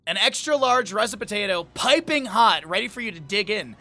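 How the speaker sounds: playful restaurant menu pitch; mock ad read. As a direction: sell the baked potato like a commercial. Reads menu description aloud.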